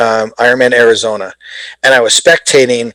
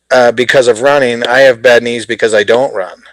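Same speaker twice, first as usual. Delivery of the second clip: ordinary delivery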